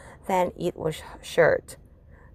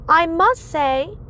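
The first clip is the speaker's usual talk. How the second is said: higher, slow teacher's compliment; 8 semitones higher, 3 dB louder, slower than usual. Acted. Teacher praising her third graders, higher and slow. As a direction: play the pleased teacher, drawing it out.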